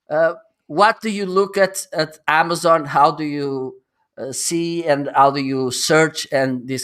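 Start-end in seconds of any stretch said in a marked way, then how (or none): none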